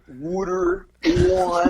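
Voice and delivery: South Jersey "water" as "wooder", pitched high; South Jersey accent. Shown as a demonstration. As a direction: say "water" like a Philly local. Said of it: "key words here to listen for".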